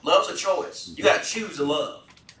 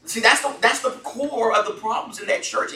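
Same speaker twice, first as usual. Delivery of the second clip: loud, raised-pitch preaching; impassioned preaching; 7 semitones higher, 6 dB louder than usual. Real, not acted. Preacher naming the church's core problem, impassioned. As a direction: press the point home, high and forceful.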